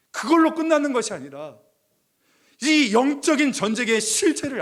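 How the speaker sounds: rally the congregation with passion; fiery preaching. Acted. Preaching at full force. (열정적으로 높고 크게 외치는 설교)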